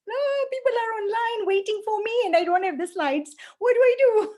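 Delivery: high, rising panic with a loud final cry; flustered alarm, voice shooting up; panicked wail. Retold or imitated. Panicking before online talk, slides gone.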